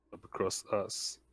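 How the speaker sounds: usual speaking voice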